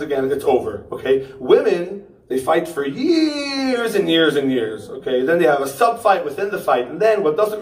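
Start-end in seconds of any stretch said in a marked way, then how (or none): 2.9-4.9 very slow, stretched YEARS; drawn-out exaggeration. Comic mocks how long women fight, drawn out. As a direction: drag the word years right out.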